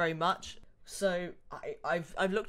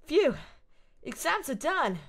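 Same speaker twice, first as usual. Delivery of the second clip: higher relieved sigh, a lightly voiced young woman; exasperated Ashley; 8 semitones higher, 4 dB louder, slower than usual. Gamer voicing Ashley glad exams are over. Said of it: "in the exasperated British voice I have".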